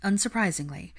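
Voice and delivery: usual speaking voice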